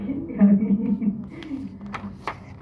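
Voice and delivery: amused chuckle tailing down; falling run of laughter. Real, not acted. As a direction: laugh at your own wishful thinking. Laughs after hoping to buy.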